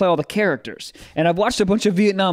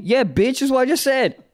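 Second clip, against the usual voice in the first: snarky; 8 semitones higher than usual. Retold or imitated. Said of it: "if you yell at me louder".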